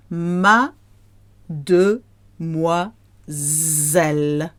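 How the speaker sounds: "Mademoiselle" split as ma-de-mwa-zelle with pauses; ma... de... mwa... zelle, spelled out slowly; slow, syllable by syllable. Shown as a demonstration. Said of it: "When you hear it fast".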